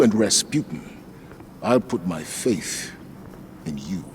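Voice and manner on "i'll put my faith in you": sincere, softer. Acted. Sincere trust to Anna.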